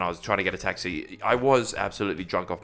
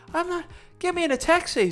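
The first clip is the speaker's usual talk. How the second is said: very high, strained outburst; mock desperation. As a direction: squeal the demand like someone in pain. Acts out his pained refusal of doctor.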